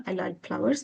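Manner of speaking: "I like flowers" softly and evenly, without stress; no intonation. Shown as a demonstration. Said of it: "এখানে কোনো ধরনের intonation নাই".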